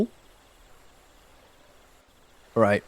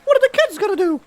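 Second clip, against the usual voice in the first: high mocking voice. Continues mocking voice.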